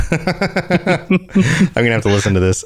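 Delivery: said through a laugh. Real, not acted. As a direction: chuckle the words out. Laughing at the find.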